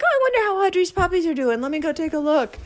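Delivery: high, fast faux-innocent voice; sing-song. Pretending to just check on puppies, high and innocent. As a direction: act casually curious, high and quick. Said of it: "now I'm like".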